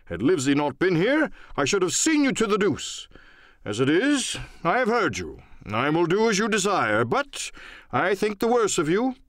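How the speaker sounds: a hint of a pompous gentleman in a huff; huffy squire.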